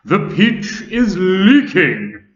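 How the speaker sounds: loud, slow high alarm; grasshopper shouting. A narrator's reading. Old grasshopper to travellers, loud alarmed shout. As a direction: cry out the leak in alarm. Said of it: "shouted the old green grasshopper".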